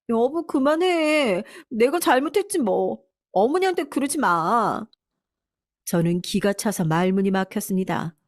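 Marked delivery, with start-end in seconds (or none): 0.1-4.8 high, drawn-out mock-meek plea; tearful pleading. Wife stopping husband's quarrel with his mother, high understated tearful plea. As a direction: plead mildly tearfully, pitch well raised. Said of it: "눈물 콧물까지 쏟으며".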